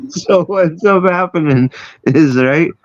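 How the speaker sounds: high voice still full of laughter; laughing. Real, not acted. Storyteller recovering from a laughing fit, still laughing. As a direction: pick the story back up through laughs.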